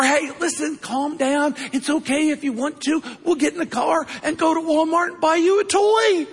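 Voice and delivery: high, building to a shout at the toy; excited bribe. Retold or imitated. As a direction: promise the toy with rising excitement.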